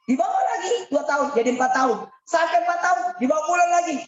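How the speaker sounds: preaching at full force; excited preaching. Acted. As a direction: drive the story on with rising energy. Preacher's building excited narration.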